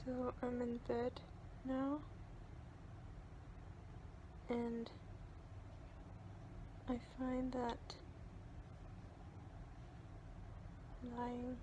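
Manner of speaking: soft, careful talk through jaw pain; with a sore jaw. Real, not acted. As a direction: speak gingerly, it hurts to talk. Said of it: "It really hurts to talk right now".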